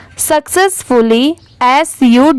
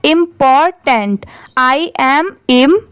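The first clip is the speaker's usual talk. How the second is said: syllable by syllable. Shown as a demonstration. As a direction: say important in parts, im-por-tent. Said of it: "इन तीनों को मिला देंगे". (Important को तीन हिस्सों में तोड़कर)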